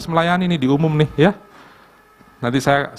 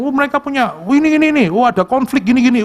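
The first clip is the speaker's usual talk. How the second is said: mimicking excited chatter; 9 semitones higher, faster than usual. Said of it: "Mereka ngomong".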